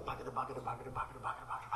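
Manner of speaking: low, hushed engine chugging; motorcycle putt-putt. Retold or imitated. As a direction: imitate a little motor puttering along quietly. Imitating his motorcycle puttering along.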